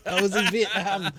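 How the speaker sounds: laughing through the words; helpless laugh spilling into speech. Real, not acted. Laughs while retelling his Welsh-accent nightmare.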